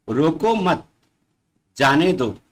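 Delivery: रोको मत run together, a break, then जाने दो; pause after मत. Shown as a demonstration. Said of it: "शब्द के साथ थोड़ा सा रुक गया है". ("रोको मत" एक साथ, फिर रुककर "जाने दो")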